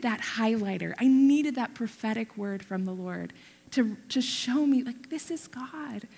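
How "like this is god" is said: softer, slow whispered this is God. Retold or imitated. God confirming his word to her, slow whisper. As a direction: breathe it like a secret.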